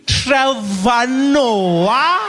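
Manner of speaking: high, loud, stretched-out name; dawning realisation, dragged out; slow suspicious recognition. Retold or imitated. Check-in woman slowly recognising the comedian, high and loud. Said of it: "She's like".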